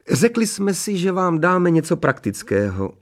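modest lady in a high-pitched voice; modest Mrs. Hermanová. A narrator's reading. Mrs. Hermanová declining the newlyweds' thanks, modestly, high-voiced. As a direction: wave off thanks in a high character voice. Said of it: "odmítla paní Hermanová skromně jejich díky".

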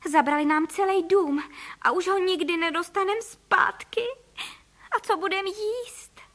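high-pitched, fretful despair; acted lament. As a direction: wail it in rising dismay. Mother laments their stolen house.

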